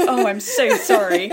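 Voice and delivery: laughing through a sorry; can't stop laughing while apologizing; laughing apology. Real, not acted. Host laughs at guest's identity crisis, laughing apology.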